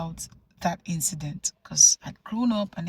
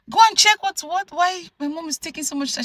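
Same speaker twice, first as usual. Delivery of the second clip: put-on impatient child; 10 semitones higher, 5 dB louder than usual. Child ordering nanny, impatient.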